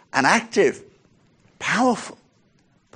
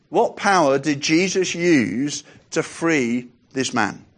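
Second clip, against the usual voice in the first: usual speaking voice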